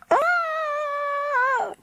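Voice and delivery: long high scream; boy screaming into a hole, long and high. Acted. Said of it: "continued Fergal".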